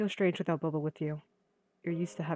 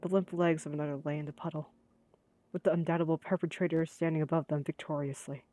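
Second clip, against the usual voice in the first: normal talking voice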